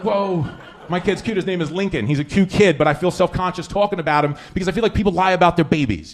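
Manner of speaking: usual speaking voice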